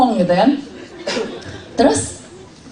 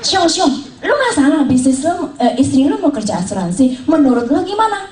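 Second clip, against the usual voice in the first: father-in-law asking; 7 semitones higher than usual. Retold or imitated.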